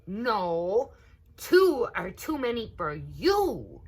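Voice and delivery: cross little alligator; squabbling child. A narrator's reading. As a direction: bicker like a spoiled sibling. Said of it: "said Quickfoot".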